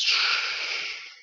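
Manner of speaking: long, soft shushing noise; slow, unvoiced-breath "shh"; hushing sound. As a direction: imitate a room falling silent with a drawn-out hush. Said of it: "goes quiet".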